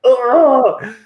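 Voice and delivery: loud, high disgusted ugh; hammed-up groan of frustration; frustrated groan. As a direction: groan at the wax like it's hopeless. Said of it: "and just, like".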